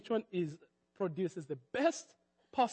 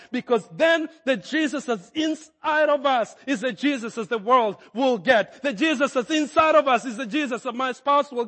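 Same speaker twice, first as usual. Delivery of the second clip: loud, high, rising preacher's call; full-force preaching; 6 semitones higher, 9 dB louder than usual. Acted. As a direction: build it up like a revival sermon.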